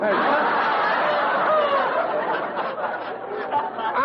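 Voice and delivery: high flustered laugh; embarrassed laugh. Real, not acted. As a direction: laugh off the blunder. Laughs at own flub.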